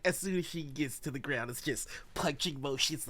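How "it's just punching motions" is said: usual speaking voice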